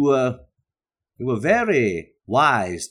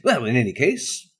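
normal talking voice

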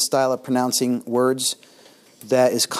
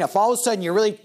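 much higher, sudden burst of voice; sudden jump up; 10 semitones higher, much faster than usual. Shown as a demonstration. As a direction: spike the voice suddenly upward. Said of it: "the increase in the volume".

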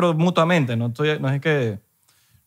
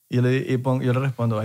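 normal talking voice

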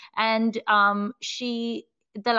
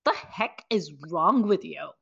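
indignant; 6 semitones lower than usual. Retold or imitated. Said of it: "And I was like".

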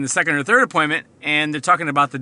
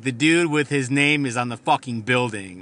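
normal talking voice

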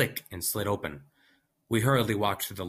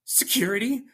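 incredulous question; 4 dB louder than usual. Acted. Scientist incredulous.

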